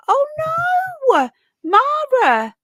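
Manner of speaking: a high, loud "oh no!" of real dismay; sudden shriek of sympathy; shocked cry. Real, not acted. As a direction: gasp it out in horror at the news. Dismay at viewer's broken nail.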